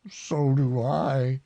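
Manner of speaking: drawn-out contented reply; Pooh. A narrator's reading. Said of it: "said Pooh".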